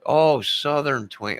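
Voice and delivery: the penny drops; dawning surprise; sudden realization. Real, not acted. Realizing misheard twang as Twain.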